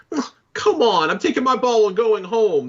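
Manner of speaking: high, loud sulky whine; whiny Star-Lord impression. Player mimicking how whiny the game's hero sounds, in a mocking whine. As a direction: whine it like a petulant quitter. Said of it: "this dude just sounds whiny".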